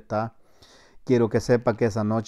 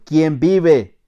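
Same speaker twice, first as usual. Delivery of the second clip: loud, raised challenge; sentry's challenge; 5 semitones higher, 8 dB louder than usual. Retold or imitated. Soldier retelling his challenge at night, loud sentry's call. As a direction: call out sharply to someone approaching.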